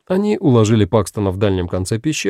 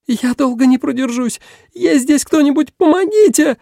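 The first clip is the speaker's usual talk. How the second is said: put-on very high girlish cry, building louder; desperate pleading girl; 4 dB louder than usual. A narrator's reading. Girl begs anyone nearby to rescue her, rising desperate cry. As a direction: plead higher and louder to the end. Same girl keeps calling for help.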